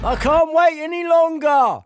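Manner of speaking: high loud mock shout. Mocking the protester's shouts.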